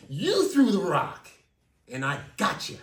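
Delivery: nail them with it; angry accusation. Retold or imitated. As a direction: Rick threatening prison. Rick accusing the kids.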